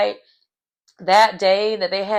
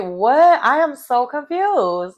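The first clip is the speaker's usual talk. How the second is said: mock confusion; 6 semitones higher, slower than usual. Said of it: "I'm just like".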